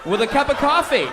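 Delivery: high, hammed-up taunt; mock menace. Comedian extending the deli threat to a fan, mock menace. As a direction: make the offer sound like a threat, pitched high.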